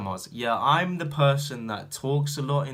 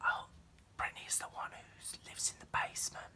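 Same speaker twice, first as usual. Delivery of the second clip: very soft, unvoiced-sounding eerie whisper; creepy child; 13 dB quieter than usual. Creepy child explaining who Brittany is, eerie whisper.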